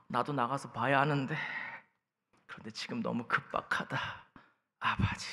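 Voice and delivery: strained, softened inner voice; hammed-up bathroom desperation, held back; acted straining. Man stuck in the toilet missing the match, mock straining.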